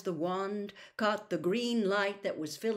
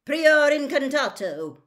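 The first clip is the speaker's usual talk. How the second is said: slow, loud, raised incantation; roared spell; 8 semitones higher, 11 dB louder than usual. A narrator's reading. Wizard casts a spell on the wand, roaring. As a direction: roar it out commandingly. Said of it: "roared Mister Diggory".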